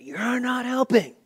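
mock the angry teen; son's angry retort. Son shouting at dad again, high slow angry voice. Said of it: "He was so mad".

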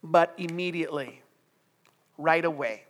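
normal talking voice

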